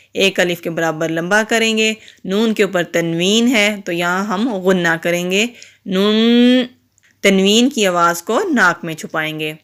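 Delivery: ordinary delivery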